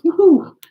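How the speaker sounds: high, loud playful whoop; joyful woohoo. Pretend. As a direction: whoop like a frisky calf. Imitating jumping calves.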